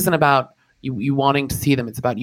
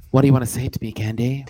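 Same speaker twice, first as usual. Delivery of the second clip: normal talking voice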